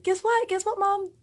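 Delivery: high, eager daughter's voice; bubbling over with big news; excited daughter. Retold or imitated. Daughter phoning Mom with big news, excited. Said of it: "She's all excited".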